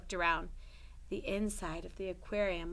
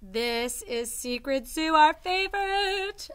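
excited; 6 semitones higher, 8 dB louder, slower than usual. Real, not acted. Cheerful intro to the book.

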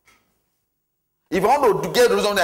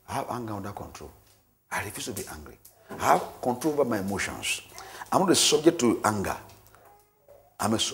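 low, soft, lower declarations of faith; prayerful confession; 8 semitones lower, 4 dB quieter than usual. Acted. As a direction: recite quietly like a private prayer.

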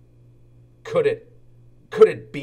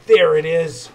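louder, delighted spotting; light up at the sight; a hint of glee, louder; happy peep; 3 semitones higher, 8 dB louder than usual. A narrator's reading. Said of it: "he peeped happily".

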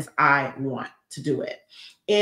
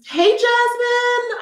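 excited greeting; 5 dB louder, much slower than usual. Real, not acted. Greeting a viewer.

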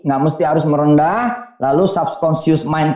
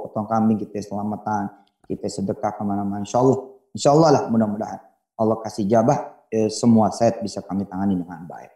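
pious contractor pitch; 6 semitones lower, 4 dB quieter than usual. Acted.

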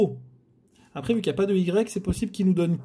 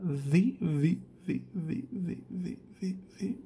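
fading murmur; 9 dB quieter than usual. Real, not acted. Repeating letter while fading away.

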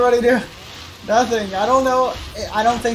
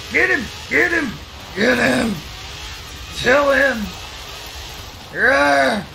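excited yelling; 5 semitones higher than usual. Real, not acted. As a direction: urge your team on at full voice. Yelling at teammates in race.

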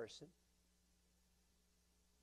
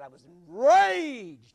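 loud, slow, furious cry; enraged shout; 6 semitones higher, 18 dB louder, much slower than usual. Acted. Preacher shouting Paul's rage at the congregation. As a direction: roar it out as a man in a rage.

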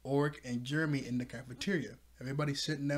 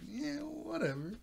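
mock character voice; 9 semitones higher, 8 dB louder, slower than usual. Mock reply in a put-on voice.

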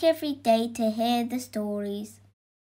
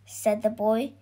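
ordinary delivery

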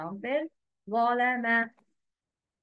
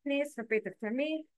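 normal talking voice

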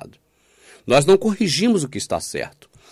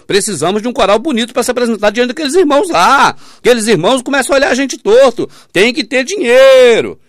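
higher and louder, slowing on tem que ter dinheiro; mock pushy leader; 10 semitones higher, 8 dB louder than usual. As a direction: parody a leader pressing for money. Mimicking money-hungry church leaders.

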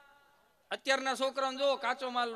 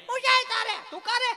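put-on much higher child voice; cheeky child; 13 semitones higher, 6 dB louder than usual. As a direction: snap back like a cheeky kid. (ઊંચો, ઉદ્ધત બાળકનો અવાજ)

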